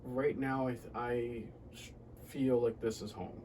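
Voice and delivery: usual speaking voice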